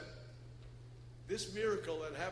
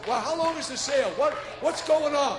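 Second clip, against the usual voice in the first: louder, higher eager asking; excited shout about a sale; eager questions; 7 semitones higher, 12 dB louder than usual. Retold or imitated. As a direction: fire off questions like a bargain hunter. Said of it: "they go".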